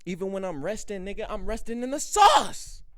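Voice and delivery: loud, high brag; boastful shout. Real, not acted. Vlogger bragging to viewers, loud shout. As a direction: shout the punchline.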